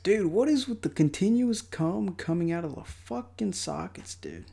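exasperated. Real, not acted. Annoyed at oozing sockets.

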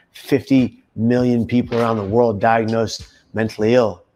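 ordinary talk

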